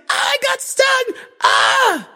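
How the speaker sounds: very high-pitched cry of being stung; panicked yelp. Retold or imitated. Man recounting his bee sting, high panicked squeal. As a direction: squeal it out like you've just been stung.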